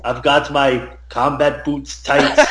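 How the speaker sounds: put-on slow, heavy Austrian-accented sing-song; drawn-out, hammed-up celebrity impression; Arnold Schwarzenegger. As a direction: badly impersonate an action star chanting a silly rhyme. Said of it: "Arnold Schwarzenegger as Billy Madison".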